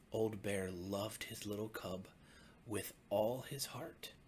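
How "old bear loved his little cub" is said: ordinary delivery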